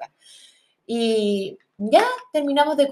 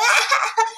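high mock laughing burst; imitated laughter; 12 semitones higher than usual. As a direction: imitate her helpless laugh. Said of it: "Y se reía". (imitando su risa aguda)

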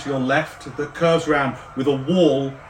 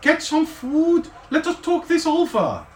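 excited; 14 semitones higher than usual. Acted. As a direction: urge a friend to sit. Zanlin excited, inviting.

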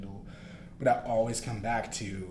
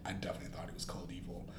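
fast hushed whisper; 13 dB quieter, much faster than usual. Real, not acted. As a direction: hush it confidentially. Repeats whispered verdict.

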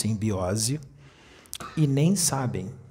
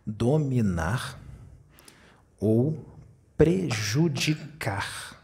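very slow, each word drawn out; over enunciating; much slower than usual. Shown as a demonstration. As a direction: lay out each word slowly. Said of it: "Então nós vamos falar bem devagar".

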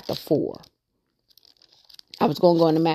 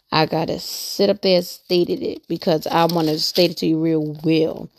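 normal talking voice

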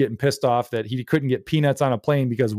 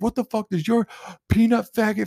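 imitated rant; 10 semitones higher than usual. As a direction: spit out an exasperated parent's complaint. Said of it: "He goes off on this rant".

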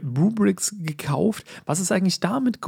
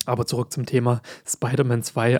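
normal talking voice

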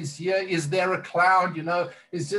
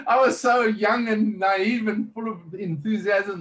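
amused; 6 semitones higher, 4 dB louder than usual. Real, not acted. Laughing recalling youthful naivety.

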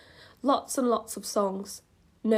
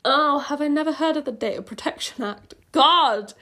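louder, higher mock outrage; exasperated self-scolding; 5 semitones higher, 6 dB louder than usual. Real, not acted. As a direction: scold yourself, ending on a loud exclamation. Scolds herself for copying.

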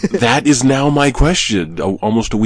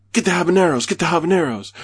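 higher-pitched, mock excited urging; imitated eager young men; 8 semitones higher than usual. Mimicking young men shopping for hot peppers, higher eager urging. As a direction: imitate a lad egging on his friend.

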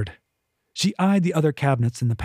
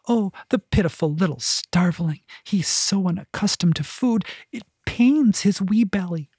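higher, doting cook cooing over a cat; kitchen woman's voice; 6 semitones higher than usual. A narrator's reading. Kitchen woman fussing over hungry cat, doting. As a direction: fuss over the poor starving cat in a raised voice.

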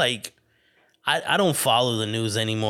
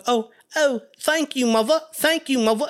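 put-on high, eager child voice; grateful little boy; 12 semitones higher than usual. Son thanking his mother for the book, high child voice. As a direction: thank her like a delighted kid. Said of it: "And I'd be like".

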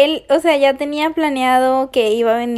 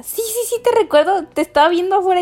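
imitating idol; 6 semitones higher than usual. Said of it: "Y le dije".

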